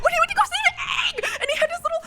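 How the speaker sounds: very high, shouting, rushing; overexcited fan. Real, not acted. Fan recalling Zuko saving Aang, shrieking high. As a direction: shriek it, tumbling over the words.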